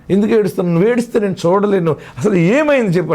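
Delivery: mildly distressed, insistent husband; concerned husband pleading. Acted. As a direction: lightly act a husband pressing his tearful wife. Husband pleads with crying wife.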